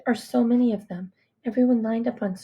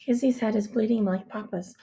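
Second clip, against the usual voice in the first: plain reading voice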